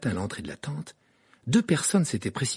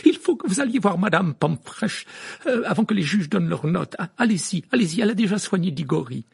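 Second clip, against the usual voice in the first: higher, louder hurried urging; brisk teacher; 8 semitones higher, 5 dB louder than usual. A narrator's reading. McGonagall sending Harry to Madame Pomfrey, brisk. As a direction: hurry him along.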